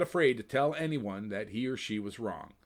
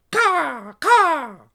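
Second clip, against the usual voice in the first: high, loud raven squawk; sharp bird call; raven caw; 13 dB louder than usual. Acted. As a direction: caw like a crow. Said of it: "Anna said".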